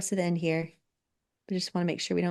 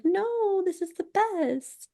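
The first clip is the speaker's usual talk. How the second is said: high mock protest; 12 semitones higher, slower than usual. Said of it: "And I was like".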